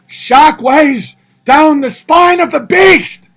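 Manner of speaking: hammed-up slow epic roar; dramatic shout. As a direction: proclaim it like an epic battle cry. Dramatic reading of donation message.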